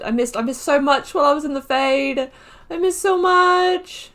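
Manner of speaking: high, stretched and gushing, building up. Real, not acted. Gamer gushing to the viewers about missing banter, high and stretched. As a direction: gush over the missed banter, voice climbing high.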